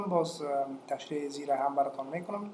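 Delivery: ordinary talk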